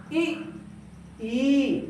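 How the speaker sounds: इ clipped short, ई held long; short i then long ii. Shown as a demonstration. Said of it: "कम समय लगा". (ह्रस्व इ, दीर्घ ई)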